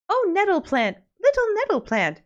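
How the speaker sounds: high, sing-song call to a plant; weird poetic address. Acted. Maid Maleen addressing the nettle plant, high sing-song. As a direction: croon at the nettle like an odd little rhyme. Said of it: "Maid Maleen just gets weird".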